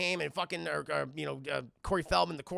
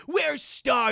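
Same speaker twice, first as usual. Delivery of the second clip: raised, loud mock demand; demanding question; 7 semitones higher, 7 dB louder than usual. Young man confronts the gang about a girl, demanding. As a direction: call it out urgently.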